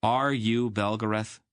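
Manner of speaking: blunt demand. Acted. Garion demanding the truth of Wolf, blunt. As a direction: demand it straight out. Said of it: "Garion demanded bluntly".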